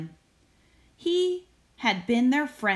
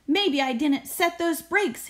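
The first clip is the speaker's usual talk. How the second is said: higher hurried call; mild shout; 7 semitones higher than usual. Acted. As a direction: driver calls to the policeman, worried. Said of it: "he shouted to the policeman".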